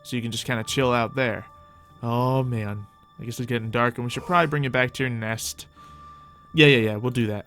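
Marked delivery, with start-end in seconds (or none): none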